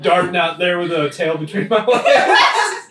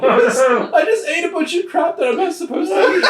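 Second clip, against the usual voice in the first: high-pitched, laughing excuse; real laughing; 12 semitones higher, 4 dB louder than usual. Real, not acted. Player excuses the character's accident to friends, laughing hard. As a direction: giggle through the excuse. Player cracks up over gross action.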